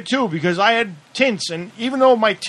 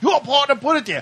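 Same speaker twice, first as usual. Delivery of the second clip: higher, pointed repeat of the accusation; indignant retort; 6 semitones higher than usual. Retold or imitated. Driver repeating to the cop that his partner planted it, sneering. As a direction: say it again with a sneer. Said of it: "I said".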